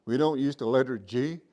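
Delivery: ordinary talk, nothing marked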